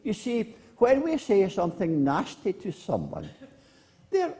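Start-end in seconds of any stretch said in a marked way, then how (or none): none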